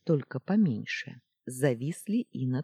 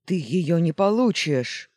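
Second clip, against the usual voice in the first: loud furious cry; angry shout; 4 semitones higher, 8 dB louder than usual. Acted. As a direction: shout it in defiance. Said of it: "гневно прокричал Александрит". (гневный крик)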